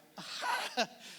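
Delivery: re-enacted burst of laughter; breathy mock guffaw; retold laughing fit. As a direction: act out cracking up. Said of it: "yo atacado de la risa".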